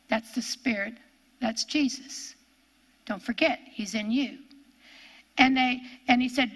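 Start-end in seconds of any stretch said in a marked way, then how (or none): none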